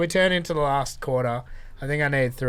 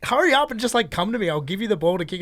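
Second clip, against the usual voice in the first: teammate; 8 semitones higher, 5 dB louder than usual. Retold or imitated. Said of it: "this guy was like".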